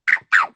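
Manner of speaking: high, loud yappy bark; imitating a dog bark. Offering to be walked like a dog, a yappy bark. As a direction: bark like a little doggy. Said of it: "I'll bark at anybody".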